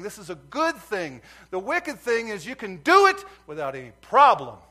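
exhortation building to a high, loud peak; forceful pulpit urging, loud key words; fervent preaching. Real, not acted. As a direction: preach it harder with every phrase.

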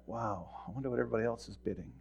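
softly and quickly, as if thinking aloud; a quiet, sneaky wonder under the breath; hushed inner thought. Retold or imitated. Earl peeking at rival bids, hushed and quick.

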